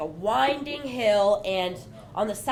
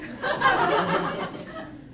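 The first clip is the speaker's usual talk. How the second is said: breathy laugh; 12 semitones higher than usual. Real, not acted. Laugh after quitting.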